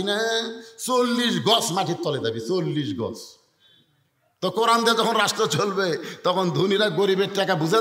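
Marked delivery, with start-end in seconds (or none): none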